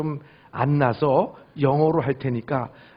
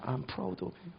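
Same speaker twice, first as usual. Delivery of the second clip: soft comfort; 15 dB quieter, much slower than usual. Retold or imitated. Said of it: "전화로 외국에서 눈물로 할 때마다".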